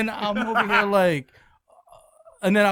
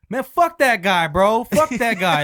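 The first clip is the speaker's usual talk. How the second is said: loud, high, dismissive swearing; mad; 4 semitones higher, 6 dB louder, slower than usual. Retold or imitated. Coworker cursing the mystery caller, mad. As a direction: imitate a man angrily writing someone off. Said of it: "He was so mad at me".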